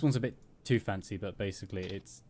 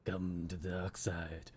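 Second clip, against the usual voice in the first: slow low Vader tempter; low slow villain voice; 4 semitones lower, 6 dB quieter, slower than usual. Retold or imitated. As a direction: lure them in slowly. Mock Vader demonic voice.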